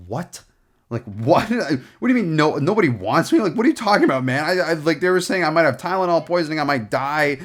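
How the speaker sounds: re-enacted incredulous outburst, building in pitch; retold stunned disbelief. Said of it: "like, speechless".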